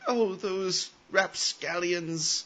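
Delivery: imitating indulgent parents, slow and lilting; mock tut-tutting parents. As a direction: draw it out like a fondly exasperated parent. Voicing dismissive parents of the kid.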